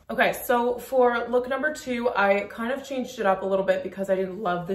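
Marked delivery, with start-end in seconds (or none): none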